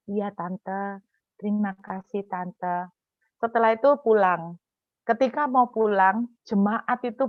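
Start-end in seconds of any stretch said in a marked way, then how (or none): 0.1-2.9 soft, submissive mumbled thanks; imitating a chastened junior; meek reply. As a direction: give in politely and quietly. Acted meek answer to scolding aunt.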